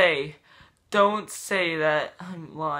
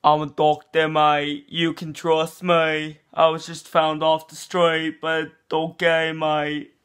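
louder lightly Aussie-tinged doctor voice; mock doctor; 5 dB louder than usual. Fake doctor assuring the vlogger, lightly Aussie and louder. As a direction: play a dodgy bloke doctor. Plays a doctor saying mate.

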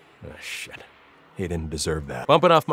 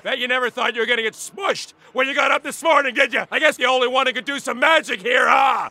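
gloating taunt; 8 semitones higher than usual. Acted. Taunting crushed magician foe.

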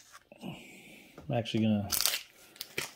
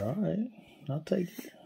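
lifted, pleased pickup; light happy lift on a good pull; 8 semitones higher, 5 dB quieter than usual. Real, not acted. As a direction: glad to take the card. Pulls a rookie card.

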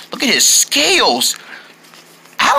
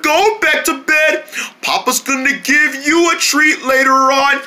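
usual speaking voice

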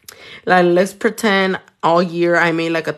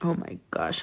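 ordinary delivery

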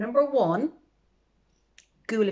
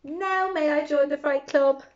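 bunny voice; 8 semitones higher than usual. Acted. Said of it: "asked the bunny".

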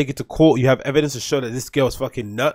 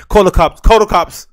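louder imitated cry for help; mock panicked yell; 5 semitones higher, 9 dB louder than usual. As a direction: yell it like the kicked girl. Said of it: "she's like".

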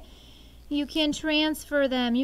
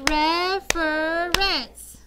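louder, very slow REF-er-ence in beats; slow syllable count; 5 dB louder, much slower than usual. Shown as a demonstration. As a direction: stretch reference into three claps.

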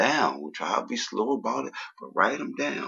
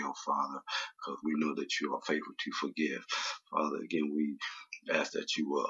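public prayer; 4 semitones lower than usual. Real, not acted. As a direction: lead the congregation in prayer, voice kept low. Leads congregational prayer.

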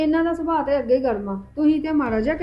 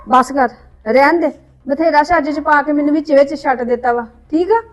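much louder furious scolding; angry shouting; 8 dB louder than usual. Acted. Sister-in-law shouting at the younger woman, furious. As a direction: lash out at her. Quarrel, shutting her down.

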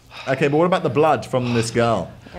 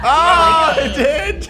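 sudden high startled yell; surprised shout; 14 semitones higher, 5 dB louder, much slower than usual. Real, not acted. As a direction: caught off guard, shout it out. Thing in game blows up.

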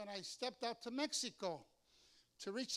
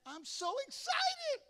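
high, gushing, drawn-out delight; mock gushing excitement; gushing "so excited"; 6 dB louder, slower than usual. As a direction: squeal it out like a thrilled fan. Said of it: "You say it all the time".

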